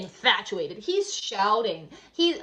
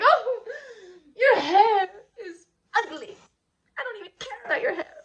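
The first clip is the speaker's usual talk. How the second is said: mock wailing tantrum; 11 semitones higher, 6 dB louder than usual. Salome spurned, throws tantrum.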